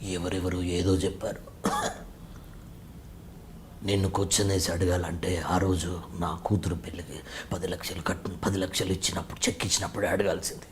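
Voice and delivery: very low, hushed, measured film voice; Nassar impression. Nassar's character confronting someone after the wedding setup, low hushed voice. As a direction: speak softly and far down, as the grave film elder. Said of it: "Nazar గారు dialogue".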